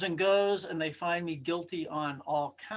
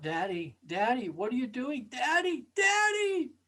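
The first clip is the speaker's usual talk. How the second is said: put-on child's terrified cry; 6 semitones higher than usual. Little son strapped in the chair calling to his father, building to a terrified high scream.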